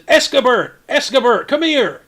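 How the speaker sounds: high, loud summoning shout; calling out. Acted. Mayor summons the castellan down the stairs, calling out. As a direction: holler for someone across the keep. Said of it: "Mayor Nighthill calls over".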